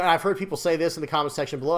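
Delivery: ordinary talk, nothing marked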